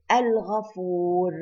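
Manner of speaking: slow Al-Ghafur with a clear L; lam pronounced. Shown as a demonstration. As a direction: draw out Al-Ghafur, sounding the lam before gh. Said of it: "we pronounce the lam".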